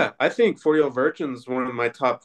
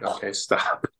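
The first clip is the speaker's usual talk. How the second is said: whispered, softer, a tired plea; worn-out dad whispering stop; weary whisper; 4 semitones lower, 5 dB quieter, slower than usual. Retold or imitated. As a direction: hush the kid who keeps the joke going. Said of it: "and I'm like".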